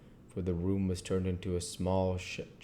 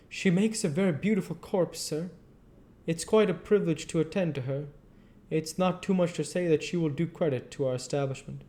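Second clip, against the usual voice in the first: higher, hushed, confiding woman's voice; confidential professional woman; 10 semitones higher than usual. Acted. Undertaker's woman confides to the doctor, higher, confiding. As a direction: share it like a trade secret between colleagues. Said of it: "in a confidential brother professional way".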